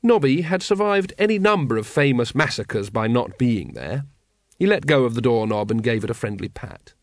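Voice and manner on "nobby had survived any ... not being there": usual speaking voice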